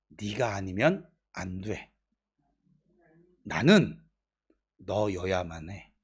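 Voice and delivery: slow, low, hushed assurance; God speaking tenderly. Retold or imitated. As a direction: say it softly and slowly, each word weighted. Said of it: "이렇게 말씀을 하시는 거예요". (느리고 낮게, 나직이 다정한 하나님 말씀)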